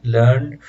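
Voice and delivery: लर्न said as one syllable, ending on n; लर्न with a plain final n; American learn. Shown as a demonstration. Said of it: "American है".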